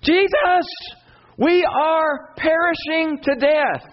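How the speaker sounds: disciples' panicked scream over the storm; disciples screaming. Retold or imitated. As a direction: cry out to Jesus in a panic, very high. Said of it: "scream out".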